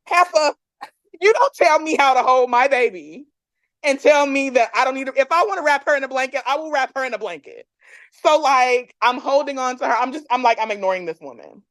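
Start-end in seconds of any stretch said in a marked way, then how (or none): 0.1-7.7 higher, emphatic telling-off; indignant retort. Retold or imitated. Mother to midwife about holding her baby, indignant. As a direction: put her firmly in her place, pitched up.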